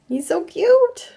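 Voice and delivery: cooing. Real, not acted. Cooing over a toy.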